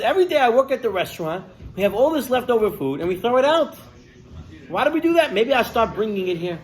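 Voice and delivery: worked-up thinking out loud; struck by an idea. Retold or imitated. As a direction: think aloud with rising indignation at the waste. Homeless-food idea thought aloud.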